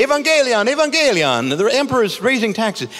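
hammed-up town crier announcing news. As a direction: cry it out to the whole town square. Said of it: "He would say".

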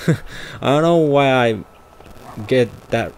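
normal talking voice

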